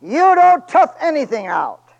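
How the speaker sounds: loud, slow, high preaching cry; full-force preaching. Acted. Preacher thundering at his church, loud and slow. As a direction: hammer the point home.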